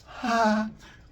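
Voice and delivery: high, loud delighted laugh; gleeful laugh. Acted. Zeus laughing with glee at the weapons news. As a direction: laugh like you just struck gold.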